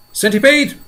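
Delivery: high, loud call; James yelling. A narrator's reading. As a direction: call out urgently. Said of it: "yelled James".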